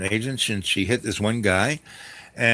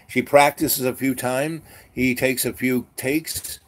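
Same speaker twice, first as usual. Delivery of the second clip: normal talking voice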